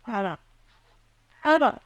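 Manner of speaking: high call, rising on the repeat. Acted. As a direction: call her name with growing urgency. Calls after her in alarm.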